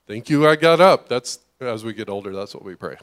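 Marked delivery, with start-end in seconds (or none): 0.1-1.1 loud mock thanks. Mock prayer of an older person.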